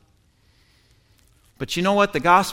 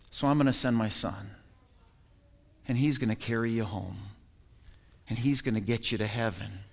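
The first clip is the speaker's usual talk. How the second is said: tender voice of God; 6 semitones lower, 10 dB quieter than usual. Acted. God's tender reply voiced.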